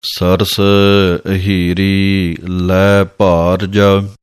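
slow measured scripture chant; slow devotional recitation of gurbani. Shown as a demonstration. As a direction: draw each word out reverently. Recites scripture text slowly.

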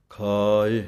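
ਖਾਇ ਦਾ ਕੰਨਾ ਲੰਮਾ ਖਿੱਚ ਕੇ; ਖਾਇ, ਆ ਨੂੰ ਲਮਕਾ ਕੇ ਬੋਲੋ; long vowel. Shown as a demonstration. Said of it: "ਖਾਇ ਲੰਮਾ ਕਰਕੇ ਬੋਲਣਾ ਹੈ". (ਲੰਮੇ ਆ ਵਾਲਾ ਖਾਇ)

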